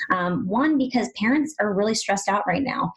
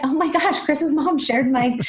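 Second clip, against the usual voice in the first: higher, retold thrilled astonishment; delighted surprise; 7 semitones higher than usual. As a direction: replay your happy shock in a raised pitch. Acted thrilled surprise at share.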